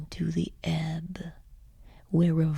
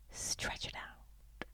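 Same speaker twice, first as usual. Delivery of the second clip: fading whisper; 18 dB quieter, slower than usual. Acted.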